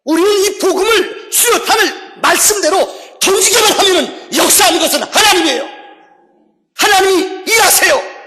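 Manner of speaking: high, loud, emphatic pulpit shout; preaching at full force. Acted. Preacher urging the congregation to trust God's work, full-force proclamation. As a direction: proclaim each phrase at full volume.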